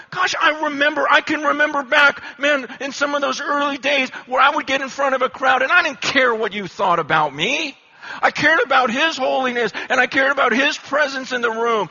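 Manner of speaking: high, loud, passionate recalling; ringing preacher's zeal; fired-up memory. Retold or imitated. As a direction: relive the bold early days with fire. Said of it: "I'm going".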